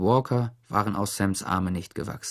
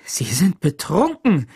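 high, loud, stretched-out accusation; indignant scream; 7 semitones higher, 6 dB louder than usual. A narrator's reading. Teacher struggling free of the sheriff's grip, screaming. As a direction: cry it out slowly and loudly. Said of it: "schrie sie".

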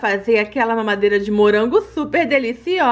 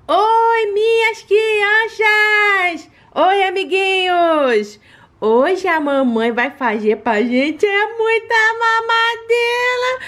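cartoon princess; 7 semitones higher, slower than usual. Greeting children on channel.

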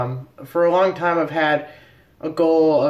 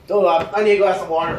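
ordinary delivery

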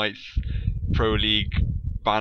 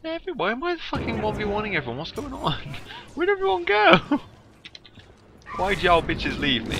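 mock panic; 10 semitones higher, faster than usual. Real, not acted. Alone against everyone, panicking.

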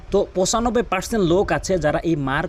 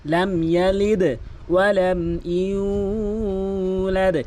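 very slow, drawn-out ইউলাদ; Quran recitation; 2 semitones higher, much slower than usual. Shown as a demonstration. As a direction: chant the verse slowly.

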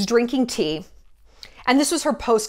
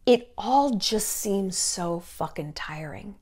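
weary whisper; 3 semitones lower, 6 dB quieter, slower than usual. Real, not acted. Confiding weary judgment.

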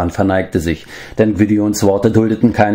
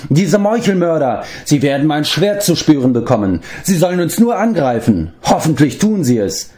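much higher, fiery bravado; indignant cry; 9 semitones higher than usual. A narrator's reading. Bard dares the assassins to attack, high-pitched indignant cry. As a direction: bard crying out a bold threat. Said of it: "schrie der Barde".

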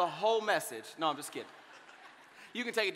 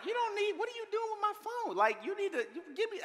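mock fluster; 9 semitones higher, 4 dB quieter than usual. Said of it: "I was like".

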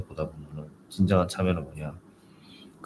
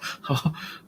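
a soft teasing spirit giggle; sly little laugh; impish giggle; 9 semitones higher, 4 dB quieter than usual. Retold or imitated.

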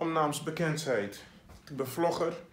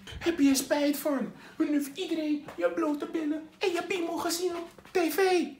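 mock critic; 10 semitones higher than usual. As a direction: put on a nosy critic. Imagined critic asking about regret.

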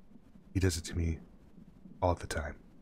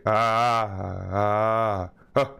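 loud drawn-out ah into the mic; loud open ah to check the meter; test yell; 2 semitones higher, 8 dB louder, much slower than usual. Real, not acted. Testing mic with loud yells.

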